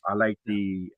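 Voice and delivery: ordinary delivery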